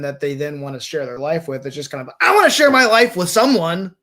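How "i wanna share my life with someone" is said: loud, high eager outburst; put-on loud eagerness; mock-eager declaration. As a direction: belt it out like an over-keen contestant. Mimics contestants desperate for commitment.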